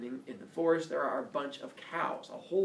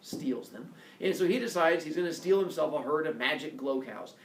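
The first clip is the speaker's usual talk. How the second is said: ordinary delivery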